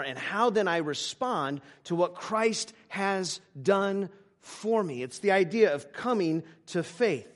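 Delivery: building pulpit proclamation; emphatic preaching. Real, not acted. Preaching the gospel with force.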